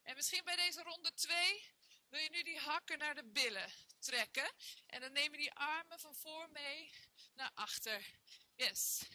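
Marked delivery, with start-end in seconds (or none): none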